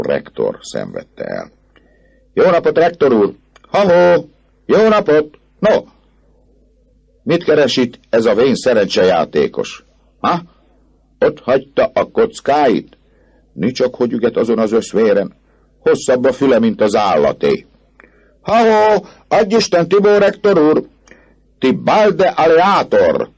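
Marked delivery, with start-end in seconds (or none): 2.3-5.8 very high, loud jeering hail; taunting crowd calls; mocking shouts. A narrator's reading. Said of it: "csúfondáros megjegyzésekkel és gúnyos". 7.2-17.6 raised, derisive student heckling; high-pitched taunting; mocking remarks. A narrator's reading. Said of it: "csúfondáros megjegyzésekkel és gúnyos". 18.4-23.3 very high, loud taunting hail; jeering crowd call; mocking shouts. A narrator's reading. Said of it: "csúfondáros megjegyzésekkel és gúnyos".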